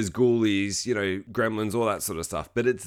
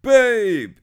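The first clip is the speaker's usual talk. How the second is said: loud, high drawn-out call; mock lovers' cry; 11 semitones higher, 10 dB louder than usual. Reenacts lovers' reunion call.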